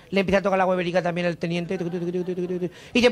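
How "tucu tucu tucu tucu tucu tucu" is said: quiet, lower tucu tucu patter; hushed low tapping noise; soft tucu sounds. Acted. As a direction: murmur the tucus low and soft. Lieutenant feeling them too.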